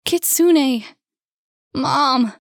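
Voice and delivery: high, loud calling; alarmed cries. Acted. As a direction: cry out in panic. Both cry out in the bedroom.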